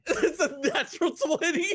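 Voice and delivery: high laughing delight; laughing excitedly. Real, not acted. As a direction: laugh out the good news. Rolls a natural twenty.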